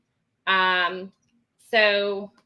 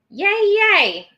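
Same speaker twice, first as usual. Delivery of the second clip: high, louder little cheer; cheery yay; 11 semitones higher, 7 dB louder than usual. Real, not acted. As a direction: celebrate the right answer. Cheering Mary Ellen's answer.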